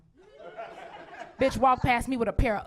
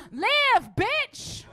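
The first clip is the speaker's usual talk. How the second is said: high, loud triumphant cry; exultant comeback; 11 semitones higher, 5 dB louder than usual. Acted. Mother answering daughters about empty nest, exultant. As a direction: crow it in mock jubilation.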